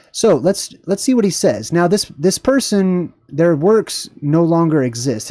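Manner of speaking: ordinary talk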